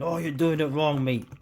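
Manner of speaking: mock heckler. Imagined viewer scolding him about his pouring, mock heckling. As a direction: cut in like a know-it-all onlooker. Mimics a critic of his pour.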